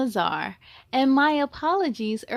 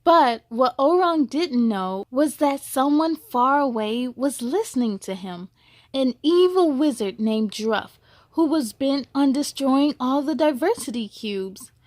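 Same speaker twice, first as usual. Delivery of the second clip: gentle, measured storybook reading; story narration; 5 dB louder than usual. A narrator's reading. Reading a picture book aloud to young listeners, gentle storytelling. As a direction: read it like a bedtime story to kids.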